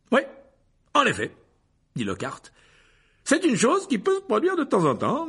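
high, loud, showy teacher's voice; vain teacher. Acted. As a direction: preen and reassure at full volume. Lockhart voiced by narrator.

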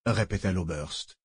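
ordinary delivery

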